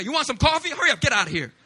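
high, loud, brusque host; rushed American host. Retold or imitated. Mimicking an American host rushing a guest, brusque. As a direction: hurry the guest out. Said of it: "In America".

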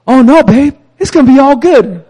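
loud, high, breezy optimist; husband brushing off trouble to wife, cheerful and loud; cheery reassurance to wife. Retold or imitated. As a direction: brush off the bad news to his wife, bright and loud. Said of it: "and I go".

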